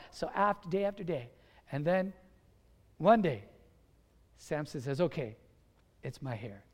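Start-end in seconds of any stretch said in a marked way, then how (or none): 5.0-6.6 low, soft admission; quiet confession. Acted. Samson gives Delilah his secret, quiet confession. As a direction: give in and drop the voice.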